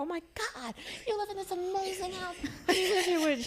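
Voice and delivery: very high, gushing retold amazement; amazed exclamation. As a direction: squeal in awe, breaking into laughter. Retold amazement at a grand house.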